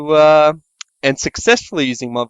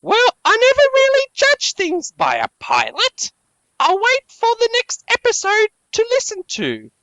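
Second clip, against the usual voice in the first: mock commenter. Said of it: "He says".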